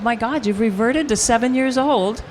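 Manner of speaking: playing the marvelling mother; a hint of an astonished mother; mother's voice. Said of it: "she said".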